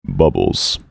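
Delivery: a mock horror voice for a silly word; deep scary voice. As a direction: say Bubbles like a monster. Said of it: "I have to do a deep, scary voice".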